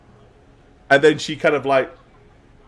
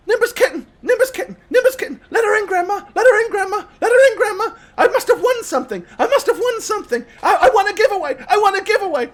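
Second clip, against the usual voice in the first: put-on very high, loud, insistent child chant; little girl freaking out; 6 dB louder, much faster than usual. Little girl begging grandma to let her idol in, freaking out. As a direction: pester grandma in a frenzy of excitement. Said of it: "she starts, like, freaking out".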